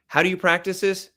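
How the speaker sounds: ordinary talk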